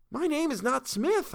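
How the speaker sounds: high, slow, hopeful pleading; hopeful plea. A narrator's reading. Captive protests his name, high hopeful plea. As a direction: reach for hope as you protest. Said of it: "with some hope left in me".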